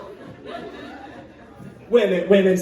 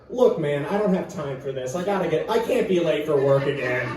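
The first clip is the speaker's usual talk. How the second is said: low, soft, unbothered mumble; bored hostage; 6 semitones lower, 4 dB quieter than usual. Pretend. Millennial hostage brushing off the robber, low and bored. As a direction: shrug it off like a nuisance.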